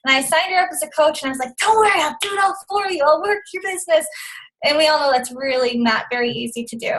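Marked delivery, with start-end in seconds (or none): 1.6-4.1 higher, rushed helpful promise; over-eager reassurance. Retold or imitated. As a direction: imitate yourself eagerly taking it all on. Retold over-eager offer to sister.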